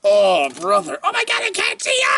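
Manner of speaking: high squealing excitement; shrieking with delight; excited squeals. Real, not acted. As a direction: squeal it out, thrilled. Excited greeting to the camera.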